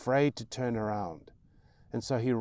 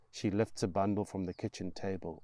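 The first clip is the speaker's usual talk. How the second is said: usual speaking voice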